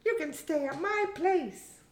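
put-on high, girlish character voice; Hamish, young daughter. Daughter figure invites her mother.

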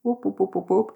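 high, quick vocal siren whoops; imitated siren whoops. Storyteller imitating police cars setting off, high whooping. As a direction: mimic a police siren with your voice.

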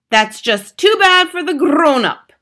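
high, loud dismissive witch; Grand High Witch. A narrator's reading. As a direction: brush it off as the imperious leader. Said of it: "said the Grand High Witch".

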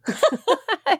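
1 laugh. Real, not acted. Host laughs at Irish name.

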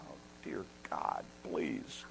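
low, near-whispered mock prayer; hushed pleading. Stuck customer praying behind a slow shopper, hushed pleading. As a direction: plead under your breath, barely voiced. Silent plea stuck in checkout line.

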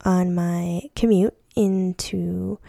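ordinary talk, nothing marked

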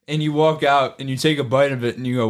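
normal talking voice

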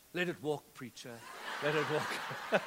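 chuckling through it, slowing at the end; laughing congregation reply. Retold or imitated. Pastor voices the congregation's deflating reply, laughing. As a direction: let the laugh break through the punchline. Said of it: "Congregation goes".

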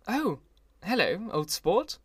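a light touch of Gatsby's easy greeting. A narrator's reading. Gatsby greets narrator warmly.

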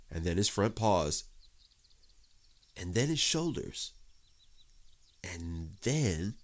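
usual speaking voice

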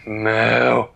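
firm, loud cow moo. Acted. Cow answering Dan who can't speak moo, firm moo. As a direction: give one firm moo. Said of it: "And the cow said".

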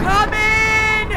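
high, loud summoning call. Acted. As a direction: summon the spirit inside. Summoning the ghost.

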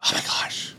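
a hushed unvoiced oh my gosh; breathy aside. Real, not acted. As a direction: whisper it in disbelief. Amazed aside to listeners.

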